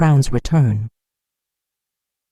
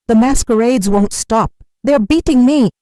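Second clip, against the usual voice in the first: higher, louder desperate plea; sobbing plea; 7 semitones higher, 5 dB louder than usual. A narrator's reading. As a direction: beg the prophet for rescue. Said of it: "he sobbed".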